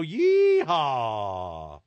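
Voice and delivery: long, drawn-out cowboy yeehaw; whooping game-show rodeo call; rodeo holler. Pretend. Rodeo catchphrase hollered by host.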